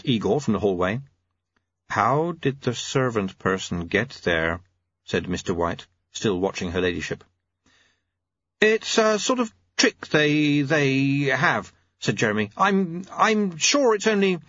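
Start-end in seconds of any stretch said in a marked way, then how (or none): 1.9-4.6 very slow, measured auditor; ponderous, deliberate official. Acted. 8.6-11.7 high, halting clockmaker; unsure high-voiced inventor; Jeremy. 12.6-14.4 high, halting clockmaker; unsure high-voiced inventor; Jeremy. Jeremy stammers in character.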